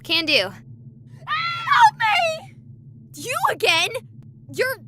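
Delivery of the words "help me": high, desperate cry for help; panicked ghost shriek; terrified scream. Acted. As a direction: scream for your life.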